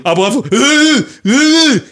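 exasperated whine. Real, not acted. Exasperated about fans.

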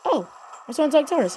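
ordinary talk, nothing marked

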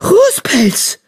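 high surprised gasp; gasped name. Acted. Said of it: "keuchte Eichhornpfote".

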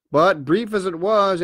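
ordinary delivery, nothing marked

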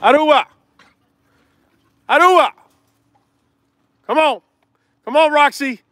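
high, loud calls across the yard; calling the dogs. Real, not acted. Breeder calling Aruba and Roxy to come in, loud and high.

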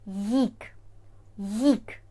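Їк said like yik, no g; teacher modelling Їк; no g sound. Shown as a demonstration. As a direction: start with a y sound. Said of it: "Не вимовляємо ге".

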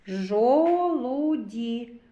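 "Жолуді" stretched slowly, the "о" held long; sound-by-sound. Shown as a demonstration. Teacher points out the vowel to children, "Жолуді" drawn out. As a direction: linger on the o in "Жолуді". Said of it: "О голосний".

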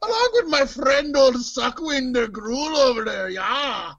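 hammed-up Irish accent, laughing through it; light Scottish-Irish brogue. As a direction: play it as a jolly Irish wrestler. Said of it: "I made him Irish for some reason, or Scottish".

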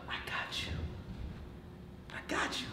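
gentle hushed reassurance; soft and reassuring. Retold or imitated. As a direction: comfort them softly. Reassuring a hurt coworker.

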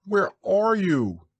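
slow, high, loud searching call; crying out. A narrator's reading. Christopher Robin searching for missing Pooh, crying out. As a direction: call into the distance, drawing it out. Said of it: "cried Christopher Robin".

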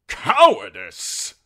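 outraged, very loud and drawn out; all but shout it, stretched with indignation; loud, affronted outburst; near shout. A narrator's reading. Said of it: "Sir Axel all but shouted".

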